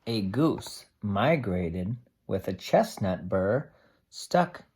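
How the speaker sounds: steady storybook read-aloud for a class; reading to children. Acted. Teacher reading a tree book to the class, steady storybook voice.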